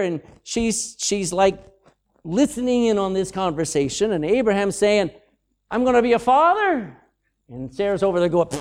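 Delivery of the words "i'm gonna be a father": high-pitched acted astonishment; amazed Abraham. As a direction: blurt it out in mock disbelief. Abraham voiced reacting to news.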